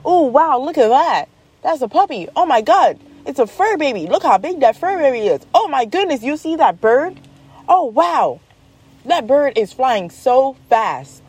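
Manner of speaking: high, sing-song wonder; imitated little girl's running exclamations; amazed child. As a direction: gush like an easily amazed kid. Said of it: "She was like".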